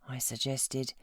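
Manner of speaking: plain reading voice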